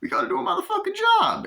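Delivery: shrieking demand; squealing actor impression. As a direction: insist on the job at the top of the voice. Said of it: "doing the impressions of the, uh, actors".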